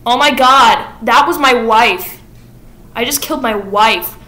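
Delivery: hammed-up shock and grief; horrified realization. Sweeney realizes he killed his wife.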